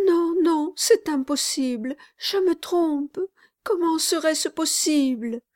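put-on a little boy giving up; high, disappointed child; small child. Child voiced, doubting.

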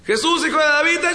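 high pleading call, cut short; acted beggar's yell; shouting. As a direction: start a big cry for attention and get cut off. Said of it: "Y gritó, y gritó".

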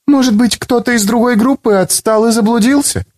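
put-on unsure young student; young woman voice. A narrator's reading. Ivetta character voice.

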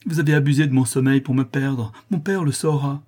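high, loud accusation; indignant outcry. A narrator's reading. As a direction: accuse him, threatening exposure. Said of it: "m'écriai-je".

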